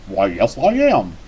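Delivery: very low cowboy impersonation; John Wayne. Retold or imitated. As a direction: drop deep, then lift on the last words. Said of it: "you sound like John Wayne".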